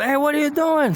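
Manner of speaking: high mock holler at a stranger; retold holler. Neighbor catches them dumping, mock hollering. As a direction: call out sharply, half-acted. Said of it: "Starts hollering at him".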